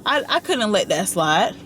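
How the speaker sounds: ordinary delivery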